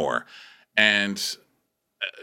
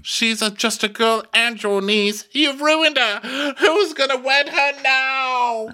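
high, wailing mock outrage; hammed-up despairing royal tirade; outraged king; 14 semitones higher, slower than usual. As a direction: drag out the words in a high anguished cry. King wailing at his brother.

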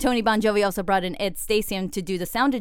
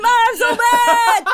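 laughing, higher fake fury; mock angry; 13 semitones higher, 8 dB louder, slower than usual. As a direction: yell it mad while laughing. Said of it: "You gotta get angry".

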